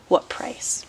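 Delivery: normal talking voice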